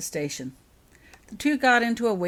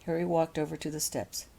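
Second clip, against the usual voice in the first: plain reading voice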